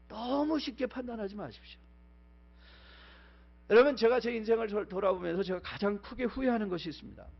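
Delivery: normal talking voice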